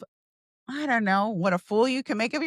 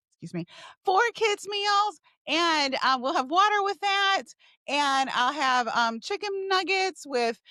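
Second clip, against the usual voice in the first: high-pitched ordering voice; acted drive-through order; 5 semitones higher than usual.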